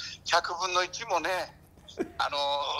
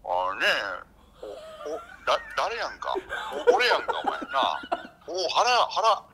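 岡田前監督; 2 semitones lower, slower than usual. Acted. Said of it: "岡田前監督はいますか？". (岡田前監督のものまねで低くぼやく)

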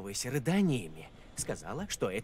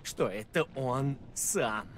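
ghost story; 4 dB quieter than usual. Acted. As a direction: drop the voice, draw it out. Tells a spooky tale.

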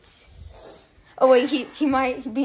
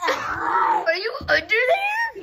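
calling out; 6 semitones higher, 4 dB louder, slower than usual. Real, not acted. Calls under the table.

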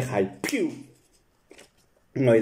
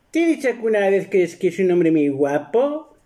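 much higher, fast wife voice; teasing wife; 7 semitones higher than usual. Retold or imitated. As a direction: tease him sweetly in her voice. (voz aguda y rápida de esposa burlona)

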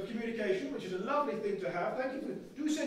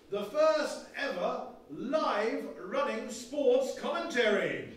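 slow announcer build-up; 2 semitones higher, 3 dB louder, slower than usual. Acted.

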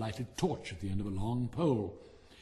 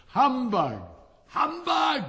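high, loud indignant shout; indignant fireworks yelling their favorite word; shouting humbug; 12 semitones higher, 10 dB louder, much slower than usual. Acted. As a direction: bellow it at full voice. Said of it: "at the tops of their voices".

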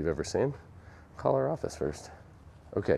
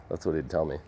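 ordinary delivery

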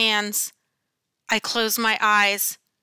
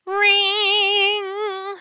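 high sung ring like a school bell; a narrator imitates the school bell; 10 semitones higher than usual. A narrator's reading. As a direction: sing out the bell.

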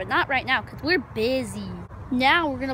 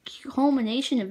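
whispered stammer; 4 dB louder than usual. Acted. Stumbles in a whisper.

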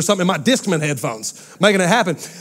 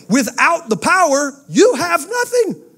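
a sudden high, slow, drawn-out cry of despair; dramatic high wail; 10 semitones higher, slower than usual. Acted. Preacher telling the congregation life without power, high and slow. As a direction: stretch it up high like it's the end of the world.

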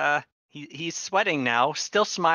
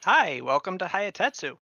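cheery, higher-pitched guard's greeting; smiling guard; 5 semitones higher, 4 dB louder than usual. Acted. Guard welcoming newcomers to town, smiling. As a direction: greet them through a smile. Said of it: "smiles at you and goes".

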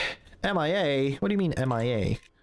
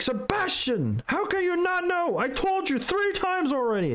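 much higher, exasperated scolding teacher voice; mocking the gym teacher. Telling friends how the teacher scolded him, a high mock scold. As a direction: ham up the teacher nagging a kid by name. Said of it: "He would be like".

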